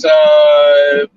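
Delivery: glitchy call from a moving car; breaking up space radio. Real, not acted. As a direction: signal cutting in and out. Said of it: "you're just kinda breaking up a little bit".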